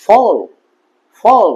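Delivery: ফল with a long, held 'aw' as in English fall; teacher modelling ফল, vowel drawn out. Shown as a demonstration. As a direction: stretch the vowel of ফল. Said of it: "দীর্ঘ হচ্ছে".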